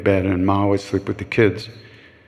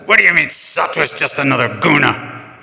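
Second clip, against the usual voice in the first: mock argument; 5 semitones higher than usual. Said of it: "We used to scream at each other".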